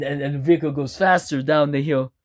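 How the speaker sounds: usual speaking voice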